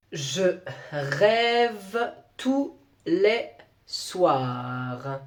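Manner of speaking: very slow, low dictation voice; drawn-out schoolmaster's dictation. Acted. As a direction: dictate word by word with long gaps. Teacher giving a mock dictation.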